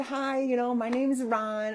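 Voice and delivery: put-on high-pitched child-friendly introduction; talking to small child. Bystander introduces self to little child, child-directed voice. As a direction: pitch it up for a toddler.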